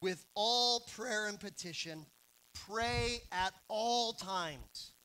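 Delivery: slow, weighty reread of the verse; slow emphatic reading. Shown as a demonstration. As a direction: pray at all times stretched out very slowly. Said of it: "But what does Paul say?".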